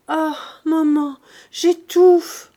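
rising, loud gasping cry of distress; anguished cry. A narrator's reading. Daughter cries to her mother, choking with anguish. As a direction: cry out as if you can't breathe. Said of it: "s'écria Eugénie".

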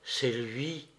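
très bas, plus doux, à peine soufflé; voix très basse. A narrator's reading. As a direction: avouer tout bas, serrée contre lui. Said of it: "d'une voix très basse". (Nastenka répond d'une voix très basse, plus douce)

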